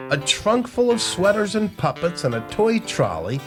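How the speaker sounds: usual speaking voice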